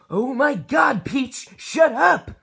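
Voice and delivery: loud, high exasperated yell; angry shout. Acted. Preacher yelling at the noisy churchgoer to shut up. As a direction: shout her down, fed up.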